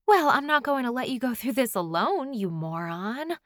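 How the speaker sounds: fond incredulity, slowing on the insult; loving exasperation. A narrator's reading. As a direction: scold your friend as if the idea were absurd. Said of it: "She says it as if I'd be a complete idiot".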